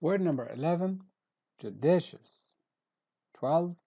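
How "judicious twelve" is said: ordinary delivery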